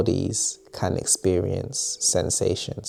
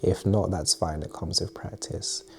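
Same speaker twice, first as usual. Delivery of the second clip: calm meditation guide, long pauses between phrases; unhurried, quietly spoken breathing exercise; guided meditation; 4 dB quieter than usual. Acted. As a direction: ease the listener gently into relaxation, softening at phrase ends.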